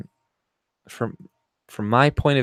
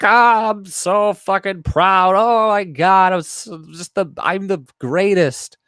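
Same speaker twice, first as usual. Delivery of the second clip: mock pride; 7 semitones higher, 3 dB louder than usual. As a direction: parody someone bragging about how proud they are. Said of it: "and they're like".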